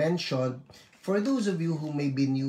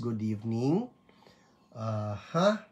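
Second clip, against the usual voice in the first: usual speaking voice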